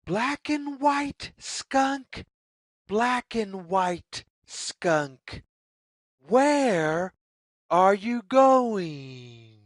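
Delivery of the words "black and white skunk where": plain reading voice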